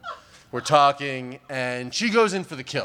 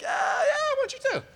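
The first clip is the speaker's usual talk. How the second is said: a squeaky, much higher, overeager yes; eager high yelp. Retold or imitated. Comic quoting his overeager reply to her, squeaky high yelp. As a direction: yelp it like a guy who can't believe his luck. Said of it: "and I'm like".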